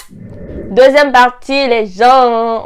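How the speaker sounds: loud, drawn-out title call; section announcement. Pretend. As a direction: belt out the section title, stretching the words.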